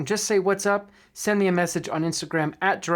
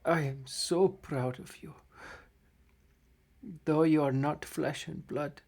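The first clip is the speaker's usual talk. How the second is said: somber game master narration; 3 semitones lower, 6 dB quieter than usual. A narrator's reading. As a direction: narrate the death scene gently. Game master narrates dying gnome.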